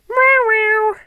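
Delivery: high, loud meowing like a cat; a grown man earnestly meowing at a cat; cat meows. Acted. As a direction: meow at the cat as if you speak its language. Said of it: "It'll help you talk cat".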